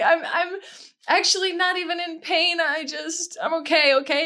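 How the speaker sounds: high, weepy reassurance; hammed-up crying protest; mock tearful. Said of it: "just gonna cry really hard".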